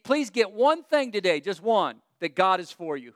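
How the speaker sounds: ordinary talk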